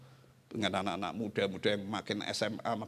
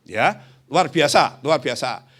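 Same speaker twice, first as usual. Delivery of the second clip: exclaiming; 2 semitones higher, 10 dB louder, slower than usual. Real, not acted. Preacher exclaims.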